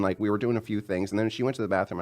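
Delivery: ordinary talk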